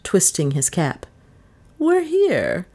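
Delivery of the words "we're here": high, pointed and odd; curious voice. A narrator's reading. Mistress hinting to the gardener, curious tone. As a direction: say it with a knowing edge. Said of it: "in a curious voice".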